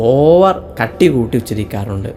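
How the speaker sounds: ordinary talk, nothing marked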